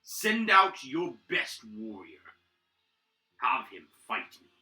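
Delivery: villain taunting the keep's defenders; half-dragon warlord. Acted. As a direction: gloat down at the defenders. Half-dragon hails the keep.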